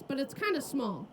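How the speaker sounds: ordinary talk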